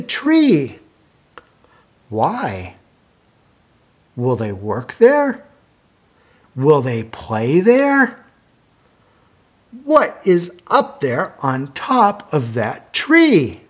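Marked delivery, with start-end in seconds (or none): none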